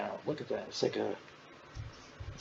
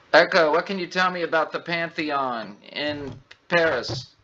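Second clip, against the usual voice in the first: louder, higher voice-command delivery; calling the assistant; 6 semitones higher, 5 dB louder than usual. Real, not acted. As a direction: address a voice assistant clearly.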